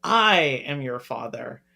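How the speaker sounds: a deep, low mock Vader voice; half-serious Vader imitation; Darth Vader line. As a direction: drop the voice low and slow for the big reveal. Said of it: "And to then be told".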